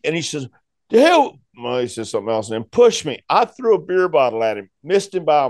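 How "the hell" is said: a much higher, louder "The hell"; angry snap. Retold or imitated. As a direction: bark it at him before the shove. Ricky pushing him.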